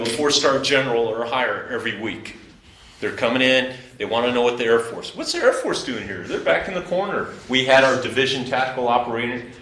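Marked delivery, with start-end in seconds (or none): 5.1-7.3 high-pitched imitation of curious brass; mimicked visiting generals. Visiting generals asking about the Air Force, mimicked in a higher voice.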